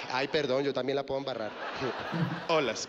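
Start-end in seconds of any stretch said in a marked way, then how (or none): 2.5-2.9 "Olas" said with a clear added S at the end; "Olas" con S final. Shown as a demonstration. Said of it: "con las S". ("Olas" con una S final marcada)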